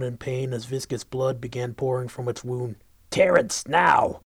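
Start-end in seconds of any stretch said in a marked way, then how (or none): 3.1-4.2 sharp call across the battlefield; shouting command. Acted. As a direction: bark the order loud. Liz calls out command.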